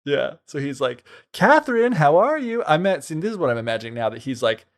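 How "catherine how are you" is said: high, loud gleeful call down a trapdoor; triumphant prince calling down to a supposedly dead wife. Retold or imitated. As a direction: sing it out high like he's won.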